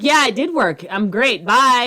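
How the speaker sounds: high, bright sing-song dismissal; fake cheerful brush-off. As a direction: chirp it out and hang up on them. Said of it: "I'm like".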